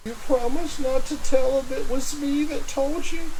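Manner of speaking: high, nervous whispered bargain; frightened plea. Acted. Clevis to his captor, frightened. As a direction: beg for secrecy.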